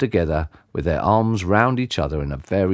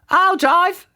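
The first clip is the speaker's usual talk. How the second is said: high eager auntie claiming the wheel; a bride keen to drive first; Auntie Flip; 4 dB louder than usual. A narrator's reading. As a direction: grab the keys as the auntie. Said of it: "said Auntie Flip".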